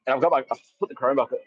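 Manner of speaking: usual speaking voice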